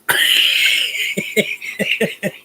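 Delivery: breathy relieved laugh; relieved laughter. Real, not acted. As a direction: laugh it off after a scare. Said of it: "That scared the hell out of me".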